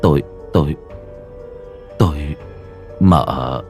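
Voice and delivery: low, faltering attempt to speak; hesitant stammer. Servant told to stop by the mistress, hesitant low stammer. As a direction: trail through long halting pauses.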